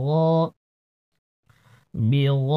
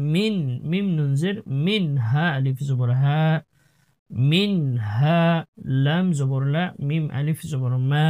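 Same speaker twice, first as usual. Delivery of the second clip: normal talking voice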